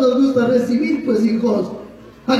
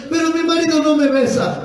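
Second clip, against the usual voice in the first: high-pitched imitation of a wife; complaining wife; 6 semitones higher than usual. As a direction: play a wife's complaint, pitched up. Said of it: "Hay mujeres que dicen". (esposa quejándose, voz aguda imitada)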